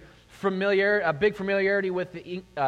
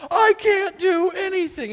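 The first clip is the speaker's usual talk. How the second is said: mock despairing wail, much higher and louder; helpless cry; 11 dB louder, slower than usual. Flustered dad on a plane with his screaming baby, high despairing wail. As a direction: throw up your hands, slow and high. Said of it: "you're like".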